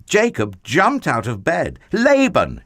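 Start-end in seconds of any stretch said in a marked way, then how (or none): 1.9-2.6 loud, high outraged call; cry out. Acted. Jacob crying out at Laban after the trick, loud and high. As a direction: yell the name in outrage. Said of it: "he cried".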